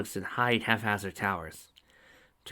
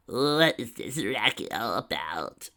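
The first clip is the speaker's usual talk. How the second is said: slow, lightly voiced old mare; a hint of a cranky old mare calling out; elderly voice; slower than usual. A narrator's reading. An old mare asks from a distance, slow and lightly aged. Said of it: "an elderly voice said".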